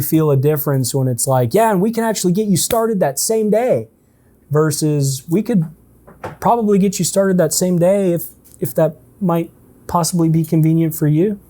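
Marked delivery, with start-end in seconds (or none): 1.5-3.9 much higher, hyped-up pushy pitch; pushy sales. Shown as a demonstration. Salesman pressing a guarded caller, hypey. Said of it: "hypey, pushy sales". 5.3-11.4 gentle, tentative, easy offer; soft language. Shown as a demonstration. Salesman offering options softly, unhurried. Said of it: "one feels very soft".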